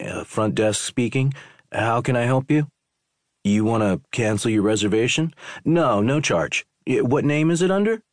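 fumbling through a cancellation call; day clerk on phone. Acted.

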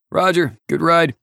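high, loud acknowledgement; loud radio reply. A narrator's reading. Viper answering the pilot on landing, loud high reply. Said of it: "Viper returned".

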